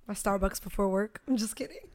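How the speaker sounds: ordinary talk, nothing marked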